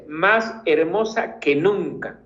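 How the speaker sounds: "más hermosa que nunca" slow, más leaned on; drawn out, más first and strongest; stress on MÁS. Shown as a demonstration. Teacher shows the adverb giving emphasis, slow and stressed.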